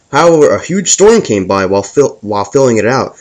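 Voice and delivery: plain reading voice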